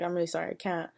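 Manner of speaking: soft, mock-apologetic refusal. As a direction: quietly imitate a reluctant apology. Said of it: "this apologetic face".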